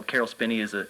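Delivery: normal talking voice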